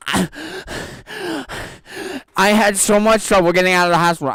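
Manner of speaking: very high, loud mock panic; hysterical customer. Imitating the customer claiming her blood pressure is rising, in hammed-up panic. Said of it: "she's like".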